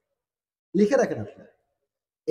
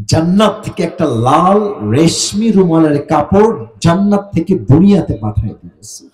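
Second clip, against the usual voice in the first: ordinary talk, nothing marked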